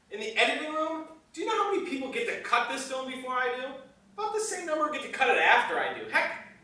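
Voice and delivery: loud, high, quick complaint; exasperated rant. Acted. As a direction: rattle off a heated grievance. Director rants about lost control.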